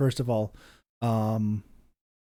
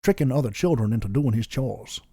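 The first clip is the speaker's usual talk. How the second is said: put-on Georgian-accented Southern narrator; Southern storyteller's Georgian lilt; lazy Georgian accent. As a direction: tell it like To Kill a Mockingbird in a Georgian accent. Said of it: "You're slipping out of Goofy".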